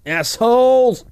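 drawn-out, high shouted assholes; mock shout. Friends mocking dog owners, a drawn-out mock shout. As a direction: bellow it slowly for the joke. Echoing the insult loudly.

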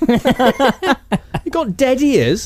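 high, loud, very slow incredulous repeat; mock disbelief. Mocking Russell Crowe's line, slow high incredulous repeat.

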